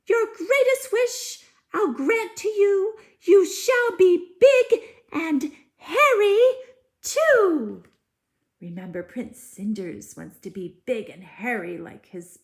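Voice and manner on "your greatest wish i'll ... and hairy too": very high, loud spell with a laugh; fairy spell. Acted. Fairy granting the big hairy wish, high and loud. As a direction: proclaim it gleefully as the fairy.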